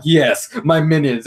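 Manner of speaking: villain boast. Retold or imitated. Retelling the villain's slow gloat to her minions. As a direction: gloat over the minions, drawn out. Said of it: "And she's like".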